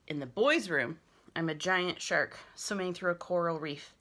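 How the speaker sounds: plain reading voice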